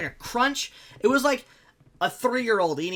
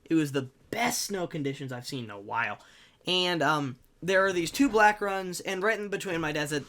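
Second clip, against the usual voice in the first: ordinary talk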